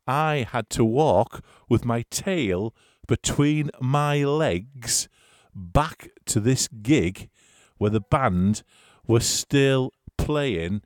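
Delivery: very slow, word by word; slow, heavy trudge through the words; drawn-out storytelling. Real, not acted. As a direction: drag out every step for comic dread. Dragging out the shamefaced walk back.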